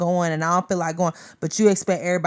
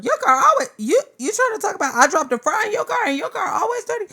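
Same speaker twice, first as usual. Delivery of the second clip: imitating a huffy passenger, pitched way up; mock indignant; 13 semitones higher, faster than usual. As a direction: play the offended friend, hammed up. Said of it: "I'ma look at you like".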